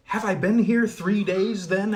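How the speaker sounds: put-on a boy amazed he lost three days; astonished. Colin astonished that three days passed. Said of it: "asked Colin in astonishment".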